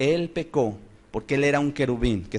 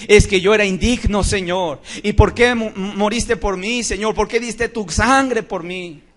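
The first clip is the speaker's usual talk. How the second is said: tearful prayer; 6 semitones higher, 4 dB louder than usual. Retold or imitated. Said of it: "se pone a llorar porque dice".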